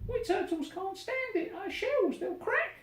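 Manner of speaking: high, pleading small-turtle voice; a tiny turtle moaning up from the bottom of the stack; groaning little turtle. Acted. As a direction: Mac begging the king for food, high and groaning. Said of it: "groaned Mac".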